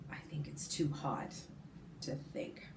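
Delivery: whispered, drowsy from the heat; too hot to talk, so whisper it; lazy whisper. A narrator's reading. Lazy boy in hot shade.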